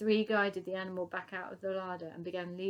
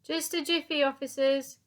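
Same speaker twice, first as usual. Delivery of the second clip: higher, loud sing-song call; 7 semitones higher, 7 dB louder than usual. Acted. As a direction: call it sweetly through the door. Dotty calling to police.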